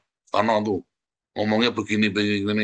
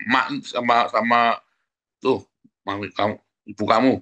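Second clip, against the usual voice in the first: usual speaking voice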